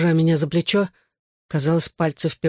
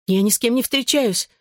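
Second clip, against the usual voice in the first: higher-pitched anxious denial; panicked objection; 6 semitones higher than usual. A narrator's reading. Daughter objects to father's accusation, mildly panicked. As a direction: protest with a touch of rising panic. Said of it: "чувствуя панический приступ".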